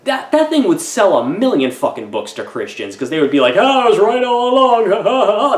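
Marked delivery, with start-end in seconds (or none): 3.5-5.6 high, loud hammed-up crowing with laughs; exaggerated victory cheer into fake laughter; mock triumphant gloating. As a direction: crow like a vindicated believer, mockingly. Imagined gloating Christians cheering.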